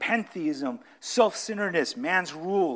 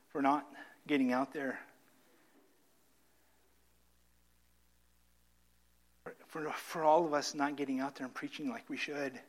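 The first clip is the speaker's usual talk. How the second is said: closing prayer; 3 semitones lower, 4 dB quieter than usual. Acted.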